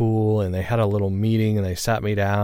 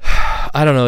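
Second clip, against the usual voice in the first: a sigh, then a louder, higher I don't know; sighing uncertainty; 5 semitones higher, 8 dB louder than usual. Real, not acted. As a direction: sigh it out, unsure and heavy. Wondering about mom's age.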